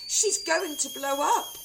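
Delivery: high, loud, slow panic; wailing. A narrator's reading. Wife wailing about grandma. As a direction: wail it in panic. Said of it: "Missus Cranky wailed".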